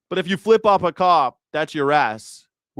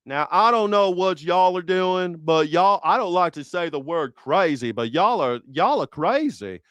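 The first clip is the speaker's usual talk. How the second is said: Doctor Phil; 3 semitones higher than usual. Retold or imitated. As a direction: put on a folksy Texan accent. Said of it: "talked a little bit like, um, Doctor Phil".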